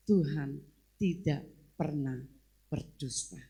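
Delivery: slow, low "Tuhan... tidak pernah... berdusta"; low-pitched, measured "Tuhan tidak pernah berdusta"; solemn declaration. Real, not acted. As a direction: break it up with weighty pauses before "berdusta". Slow solemn declaration after outburst.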